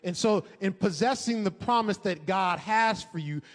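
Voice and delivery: loud preaching build; fervent preaching. Acted. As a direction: preach it with rising fire. Preaching builds to full force.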